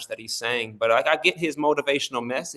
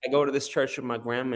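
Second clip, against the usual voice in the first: ordinary talk, nothing marked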